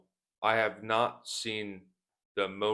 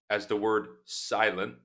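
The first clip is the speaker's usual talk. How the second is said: ordinary talk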